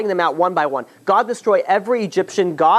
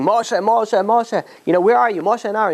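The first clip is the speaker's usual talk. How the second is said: raised calling voice, higher; 6 semitones higher than usual. Retold or imitated. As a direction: call out for Moshe across town. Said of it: "he starts screaming".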